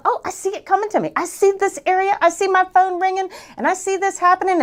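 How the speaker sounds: high, eager run of imagined sights; picture it arriving, voice lifted high; excited visualizing. Acted. Coach shows husband how to visualize, excited. Acting out picturing business coming.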